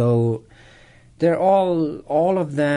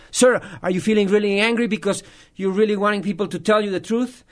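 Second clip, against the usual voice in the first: higher-pitched, quick, earnest guess; earnest retold question; 7 semitones higher, faster than usual. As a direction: act out an urgent empathic question. Retold empathic guess to attacker.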